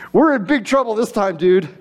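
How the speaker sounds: put-on high, breathless frightened boy; panicked kid. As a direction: blurt it out like a scared kid. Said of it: "still breathing hard ... replied".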